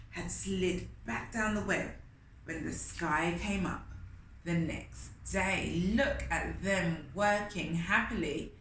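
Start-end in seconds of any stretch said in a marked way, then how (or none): none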